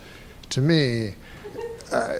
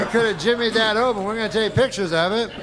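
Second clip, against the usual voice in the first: quicker, higher mock protest; mock defense argument; 7 semitones higher, faster than usual. Comic playing the defense to the jury, mock protest. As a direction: argue it fast and high, lightly hammed-up.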